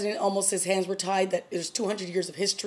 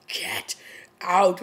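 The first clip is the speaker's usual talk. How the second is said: deep mad voice; 4 dB louder than usual. Retold or imitated. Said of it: "with her, like, deep voice that she loves to use when she's really mad".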